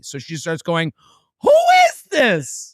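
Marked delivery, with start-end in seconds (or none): 1.4-2.7 very high, drawn-out mock drunken screech; drunk woman yelling. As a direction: imitate a drunk woman's piercing demand. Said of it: "a different type of drunk".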